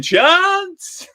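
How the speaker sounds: high-pitched imitation of Luke shouting; mock shout. Host imitating Luke's catchphrase for the bingo card, mock shout. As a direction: mimic a mate's excited football shout. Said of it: "We've got Luke shouting".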